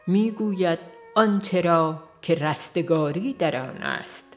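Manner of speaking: ordinary delivery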